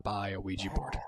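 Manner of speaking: hushed, breathy mock promise; whispered promise. Boyfriend whispering his promise to Katie. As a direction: whisper it like a sworn secret.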